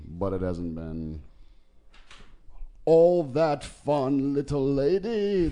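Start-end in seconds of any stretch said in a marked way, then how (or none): 2.8-5.5 put-on slow, high, louder old Brit; teetering old gent, drawn out; wobbly elder British voice. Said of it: "the wobbly elder British voice".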